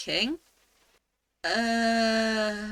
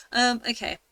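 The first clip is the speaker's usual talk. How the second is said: ordinary talk, nothing marked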